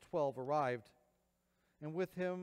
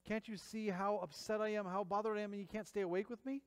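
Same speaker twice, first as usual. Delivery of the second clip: exasperated Jesus; 6 semitones higher than usual. Retold or imitated. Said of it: "He's like".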